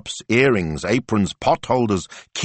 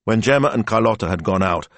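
ordinary delivery, nothing marked

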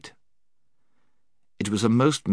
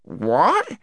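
very high gnome's startled question; squeaky astonished creature; astonished cry; 10 semitones higher than usual. A narrator's reading. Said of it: "cried the gnome".

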